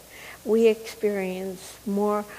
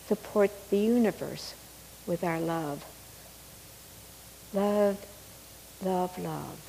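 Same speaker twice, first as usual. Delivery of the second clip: guided meditation; 7 dB quieter than usual. Acted. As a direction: ease them gently into calm. Meditation prayer into silence.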